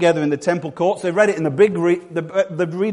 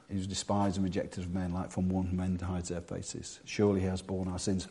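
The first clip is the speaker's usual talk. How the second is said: low, soft scripture reading; slow reverent reading; 9 semitones lower, 12 dB quieter than usual. Acted. Preacher reads Isaiah as if spotting Jesus, slow reverent reading. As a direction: read it hushed and low, dwelling on the words. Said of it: "I'll read this slow".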